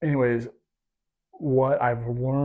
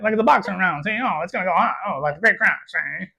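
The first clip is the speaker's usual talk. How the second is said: 1920s gangster voice, high and loud; old-timey Al Capone newsreel voice; mid-Atlantic gangster accent; 9 semitones higher, 7 dB louder, faster than usual. Retold or imitated. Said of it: "It's like a mid-Atlantic accent".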